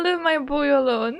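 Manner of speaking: usual speaking voice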